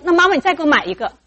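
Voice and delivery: put-on high, loud, quick little-kid plea; pleading child. Child begs mum to buy another, high pleading. Child begging mother for new toy.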